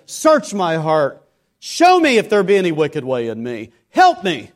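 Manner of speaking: preacher voicing a desperate prayer; earnest pleading prayer. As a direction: cry out for God to search the heart. Voices people praying earnestly.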